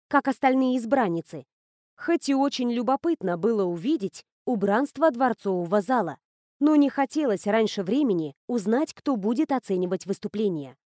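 plain reading voice

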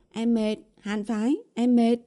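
put-on playfully whiny girlfriend; cutesy whining. A narrator's reading. Girlfriend clings to boyfriend's neck, whining cutely. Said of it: "bắt đầu mở chế độ làm nũng".

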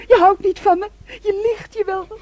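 loud, high, sobbing cry. Acted. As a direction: wail the betrayal. Daughter cries out betrayal. (luid en snikkend)

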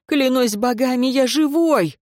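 high, desperate pleading; near tears. A narrator's reading. Wounded servant swears to master, nearly crying. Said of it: "едва не плача воскликнул Тьенер".

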